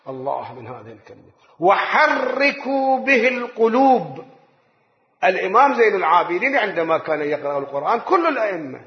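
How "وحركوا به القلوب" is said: slow, weighty reading; slow emphatic quote. Shown as a demonstration. Preacher urging to pause at the wonders, slow. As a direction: dwell on every word. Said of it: "بتأني".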